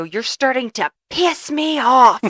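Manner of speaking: imitating a fed-up sibling; retold angry outburst. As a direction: draw it out, building in irritation. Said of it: "they heard me go".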